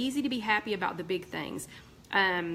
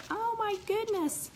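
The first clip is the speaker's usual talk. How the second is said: much higher, slow oh my goodness; real startled sympathy; shocked dismay; 13 semitones higher, slower than usual. Real, not acted. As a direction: gasp at bad news. Reacting to sister's fall.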